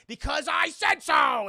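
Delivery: high, slow shouted command; mock shout. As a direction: bellow it like a spoiled boss. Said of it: "he's like".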